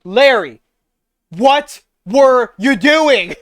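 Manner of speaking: loud, slow, fed-up demand; exasperated shout. Acted. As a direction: drag out each word in disbelief.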